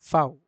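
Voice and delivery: फाउ said as German "fow", an f sound with an ow vowel; German letter V. Shown as a demonstration. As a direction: clear teacher demo of फाउ as "fow". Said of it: "German में इसको बोला जाता है फाउ".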